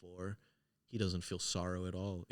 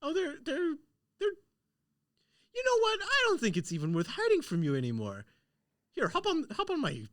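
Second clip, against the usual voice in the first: high, loud hag squeal; shrill old hag offering a ride, much higher and louder; 7 dB louder than usual. Acted. Hag inviting the player onto her cane: high, loud hag voice.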